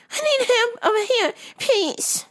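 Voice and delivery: very high, sing-song calling; hammed-up high customer voice; mock strange customer. Customer calling the worker over, very high mock voice. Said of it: "I'm like".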